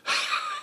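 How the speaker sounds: voiceless, compressed rush of breath; demonstrated wheeze. Shown as a demonstration. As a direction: wheeze on purpose, no voice. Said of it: "So if I wheeze".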